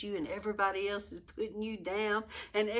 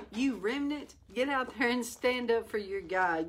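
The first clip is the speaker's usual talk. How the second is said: usual speaking voice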